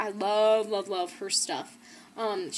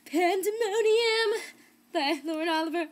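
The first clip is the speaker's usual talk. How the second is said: thrilled reveal; 9 semitones higher, 5 dB louder than usual. Real, not acted. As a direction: unveil the title like a treasure. Big reveal of second book.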